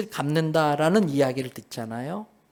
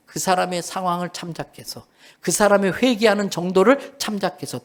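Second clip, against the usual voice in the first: normal talking voice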